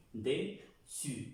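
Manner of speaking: "Dessus" in two separate syllables, de, su; double S as S. Shown as a demonstration. Teacher showing how the double S sounds, syllabified pronunciation. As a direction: say "Dessus" slowly, with an S sound, not Z. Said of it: "Pronuncia la doble S como una S".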